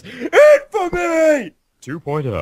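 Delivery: big booming announcer. Pretend. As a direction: boom it out like a trailer voiceover. Mock announcer hype.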